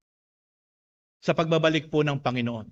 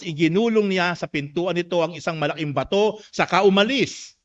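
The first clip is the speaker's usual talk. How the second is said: raised, emphatic Bible reading; proclaiming the verses aloud; scripture reading; 4 semitones higher, 4 dB louder than usual. Acted. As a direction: read the passage out briskly to a congregation.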